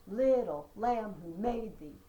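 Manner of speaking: Little lamb who made thee, stressed syllables punched and slow; trochaic stress. Shown as a demonstration. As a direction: beat out the trochees, strong then weak. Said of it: "trochaic trimeter".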